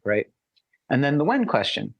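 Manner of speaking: ordinary delivery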